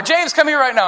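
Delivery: high-pitched, urgent stern parent; parent summoning child. Acted. Parent to James, who must come now, stern summons. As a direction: order the kid over right away. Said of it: "when your parents say your name".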